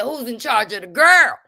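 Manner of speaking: loud, high demanding call; mock confrontation shout. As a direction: bark the question at her face.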